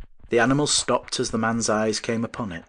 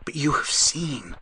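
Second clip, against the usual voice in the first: trembling voice; 6 semitones higher, slower than usual. A narrator's reading. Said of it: "His voice trembled and failed".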